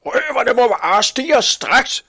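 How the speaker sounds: very high, loud, drawn-out outburst; furious demand. A narrator's reading. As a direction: shout the demand slowly at high pitch. Furious butcher demands arrests. (rasende, højt råb)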